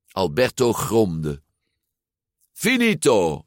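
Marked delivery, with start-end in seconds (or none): 2.5-3.4 high, excited announcement; called out. A narrator's reading. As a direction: call out that you've finished first. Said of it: "riep Giulia".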